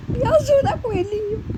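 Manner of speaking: high, loud whiny plea to the bunny; pleading baby voice. Pretend. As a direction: beg like a little kid. Begging the rabbit foot. (voz fininha implorando ao coelhinho)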